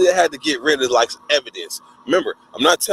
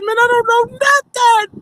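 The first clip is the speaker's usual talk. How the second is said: high, louder pleading; desperate denial; 6 dB louder than usual. Retold or imitated. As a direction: insist in panic. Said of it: "I said".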